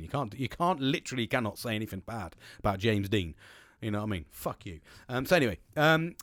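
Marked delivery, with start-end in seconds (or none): none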